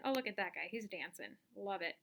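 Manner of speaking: normal talking voice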